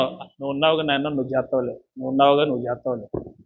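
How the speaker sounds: trailing off as if losing heart; faint-hearted. Retold or imitated.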